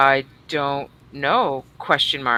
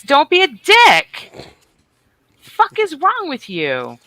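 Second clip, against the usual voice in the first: playful scold; 10 semitones higher, 5 dB louder, slower than usual. Real, not acted. Scolding Chris in chat.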